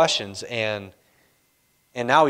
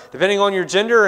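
ordinary talk

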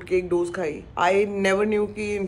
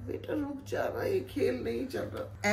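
mock whimper; 6 semitones higher, 6 dB quieter than usual. Mock crying continues.

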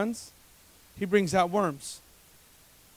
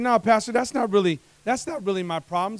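protesting; 3 semitones higher, 7 dB louder than usual. Acted. Acts out listener's protest to pastor.

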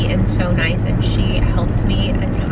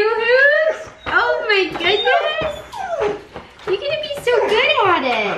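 much higher, gushing pet-talk voice; 11 semitones higher than usual. Real, not acted. Talking to her dog Hoosier.

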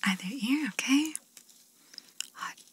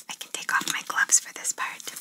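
ASMR whisper; 7 dB louder than usual. Acted. ASMR artist whispering instructions to the listener. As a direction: breathe the words gently, no voice.

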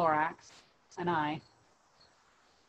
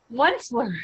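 hoarse call, raised a bit; croaky cry; 5 semitones higher, 5 dB louder than usual. A narrator's reading. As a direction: croak his name out in a cry. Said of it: "he cried with a crofulous croak".

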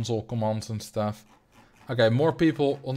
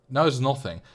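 usual speaking voice

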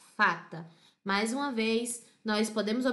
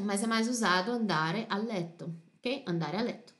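usual speaking voice

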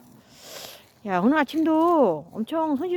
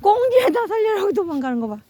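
ordinary talk, nothing marked

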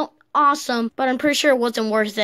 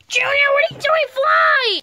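high frantic shout; panicked shouting; 11 semitones higher than usual. Acted. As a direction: yell at him to go. Panicked teammate urging him on.

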